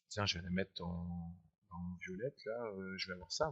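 low, soft aside while busy; muttering to self. Real, not acted. Teacher choosing a line colour on screen, low mutter. Talking to himself while drawing lines.

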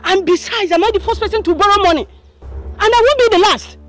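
loud, high-pitched defiance; high, heated, full-voiced comeback; angry retort. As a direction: shout back indignantly at the creditor. Debtor shouts back at creditor.

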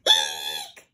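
mock shriek. A narrator's reading. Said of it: "cried Susan".

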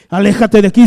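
loud commanding rebuke; a preacher acting Jesus's sharp rebuke; stern rebuke. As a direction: bark it as a sudden command.

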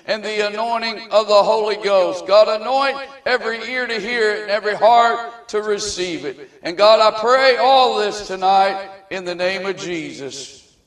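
loud, rising preacher's prayer; fervent prayer. Acted. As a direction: pray out with building fervour. Leads a fervent public prayer.

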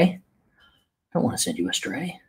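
usual speaking voice

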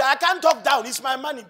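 landlord insisting. Retold or imitated. Landlord demanding rent from the tenant, loud insistence.

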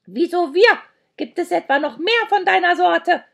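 loud, high indignant questioning; cantankerous high holler; cranky old creature. A narrator's reading. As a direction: demand it crossly in a high creature voice.

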